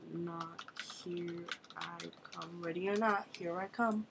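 ordinary talk, nothing marked

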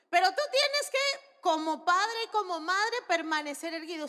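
loud, high preaching push; emphatic high-pitched sermon delivery; forceful exhortation. Real, not acted.

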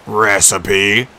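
lightly voiced monster question; a giant tempted; curious Cyclops. A narrator's reading.

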